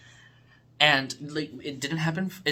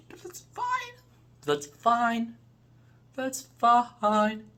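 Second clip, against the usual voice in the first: resigned; 10 semitones higher, much slower than usual. Real, not acted. Resigned repeats about weak episodes.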